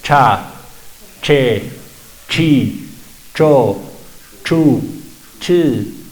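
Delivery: cha, che, chi, cho, chu, chi with the air cut before the vowel; struck zh sound. Shown as a demonstration. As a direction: drill cha che chi cho chu, each one clipped off sharply. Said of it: "entonces sale golpeado".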